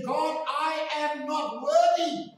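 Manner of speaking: slow, high, loud plea to God; overwhelmed cry. Acted. Preacher voices his own humbled reply to God, crying out. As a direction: cry out your unworthiness, drawing out each word.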